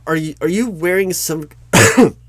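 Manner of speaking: ordinary talk, nothing marked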